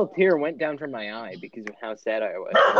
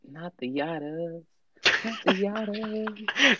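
quoting a video; 7 semitones higher, 4 dB quieter, slower than usual. Retold or imitated. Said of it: "I love that video".

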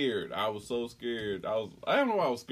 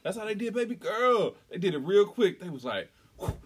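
normal talking voice

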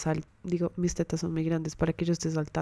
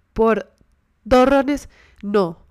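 louder, pitched-up wail of protest; tearful outburst; 4 semitones higher, 8 dB louder, much slower than usual. Retold or imitated. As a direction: cry it out in disbelief. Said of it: "yo empecé a llorar".

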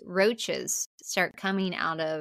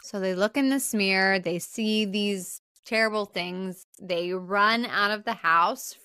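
ordinary talk, nothing marked

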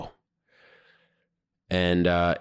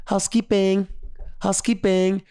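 mimicking a maid calling through the door, much higher; sing-song hotel maid announcing herself, pitched up; housekeeping call; 11 semitones higher than usual. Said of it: "guess what I hear?".

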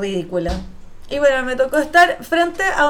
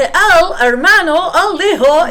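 loud, higher preacher voice in a put-on Central American accent; mock the pastor father's borrowed Central American sermon accent; imitating pastor father's Central American accent; 6 semitones higher, 9 dB louder, slower than usual. Daughter mocking her pastor father's sermon, loud Central American accent. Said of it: "tienen que imitar este acento".

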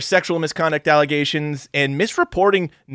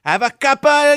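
much higher, louder English voice; mock English accent; 11 semitones higher, 6 dB louder, slower than usual. Joining the joke about royals, English accent. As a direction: chime in as a prim Englishwoman.

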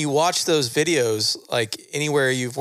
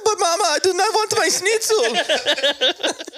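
put-on much higher, slow whiny German kid; German accent; much slower than usual. Child refusing his schnitzel, high whiny German accent. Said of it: "That's a pretty good German".

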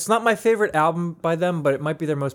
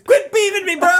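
laughing, much higher and louder; high laughing yell; 7 dB louder than usual. Acted. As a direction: squeal it through laughter. Joking about beeves.

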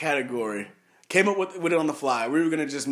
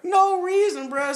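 mock scolding; 12 semitones higher, slower than usual. Mocking the crying player.

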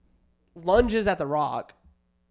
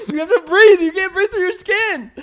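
high, loud laughing check-in; checking on Nick while cracking up; laughing concern; 13 semitones higher, 11 dB louder than usual. Real, not acted. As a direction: ask through laughter. Laughing at friend.

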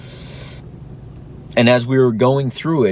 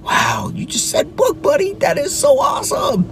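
thrilled praise. Retold or imitated. Dad cheering his toddler for saying book, very high and excited. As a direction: praise him like it is huge news. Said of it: "praising toddler".

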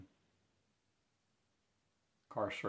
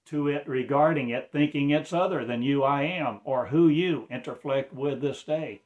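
raised, intense preacher's voice; fervent preaching; 5 semitones higher, 7 dB louder than usual. Acted. Preacher pressing his listeners, raised and urgent. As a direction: lift it into a sermon pitch.

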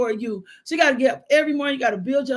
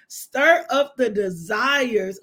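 ordinary talk, nothing marked

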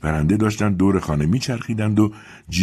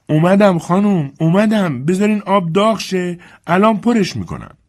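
put-on high, raised old servant voice calling upstairs; Dilsey calling up; 13 semitones higher, 6 dB louder than usual. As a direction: call up the stairs to the mistress.

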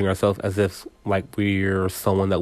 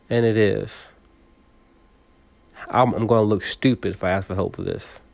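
ordinary talk

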